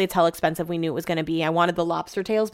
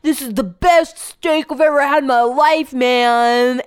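higher, louder Southern California surfer drawl; surfer dude; 5 semitones higher, 4 dB louder, slower than usual. Retold or imitated. Imitating tipsy husband praising steak, Southern California surfer voice. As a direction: gush it like a tipsy SoCal surfer. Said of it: "He sounds like a surfer from Southern California".

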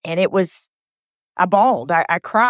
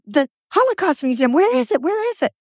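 put-on much higher, insistent old woman asking; urgent older woman; 9 semitones higher than usual. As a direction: press the question like an eager older lady. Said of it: "Just like that, an older woman".

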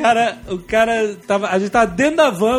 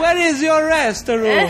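put-on slow, higher Italian-accented English; 4 semitones higher, slower than usual. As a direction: ask like a hammy Italian tourist. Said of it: "com sotaque italiano". (inglês com sotaque italiano)

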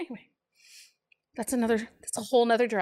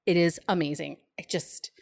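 usual speaking voice

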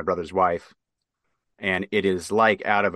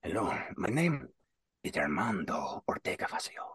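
tough-guy intro; 10 dB quieter than usual. Retold or imitated. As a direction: breathe the name like a dangerous legend. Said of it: "versus like".

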